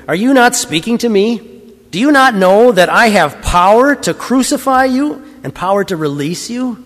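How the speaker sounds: high, pressing questions; incredulous challenge. A narrator's reading. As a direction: demand an answer with the voice lifted high. Pilate presses the silent prisoner.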